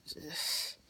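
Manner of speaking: hushed worried inner voice; whispered worry. Retold or imitated. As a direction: whisper your own worried thoughts. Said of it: "I was like".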